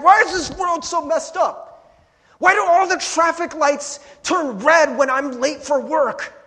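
loud, high, slow frustrated rant; mock rant. Late for work, ranting at red lights, loud slow complaint. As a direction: rail at the world, drawn out and loud.

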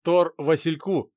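slow, loud call sign; over walkie talkie. Acted.

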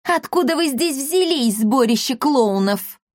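high-pitched scornful demand; exasperated girl's taunt; annoyed cry. A narrator's reading. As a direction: throw the insult out in a high voice. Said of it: "крикнула она".

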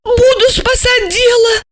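very high, whimpered reply; meek mumble. A narrator's reading. Asya obeys Gorokhov against her will, high meek mumble. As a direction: mumble it obediently, pitched high. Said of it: "промямлила Ася".

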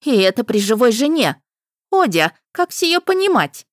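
reproachful wife. A narrator's reading. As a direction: scold her straying husband, rising high. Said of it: "укоризненно прозвучал зычный женский голос".

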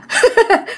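high, creepy laugh; ghostly cackle. Retold or imitated. Ghost woman mocking her victim's fear of ghosts, cackling. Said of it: "cười với chị".

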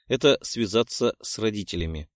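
plain reading voice

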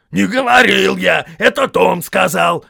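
high, shouted protest; angry denial. A narrator's reading. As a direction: shout the blame onto someone else. Accused troll denies.

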